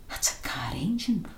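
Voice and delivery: tense whisper. A narrator's reading. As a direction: breathe it out so no one hears. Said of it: "Robbie harked".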